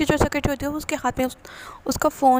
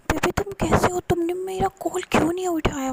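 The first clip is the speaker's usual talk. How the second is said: voiced higher, a concerned boyfriend on the phone; worried lover voice; 7 semitones higher than usual. Boyfriend anxiously calling his partner back, pitched up. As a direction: ask with caring alarm, pitched up.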